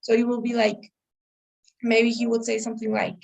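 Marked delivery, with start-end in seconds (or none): none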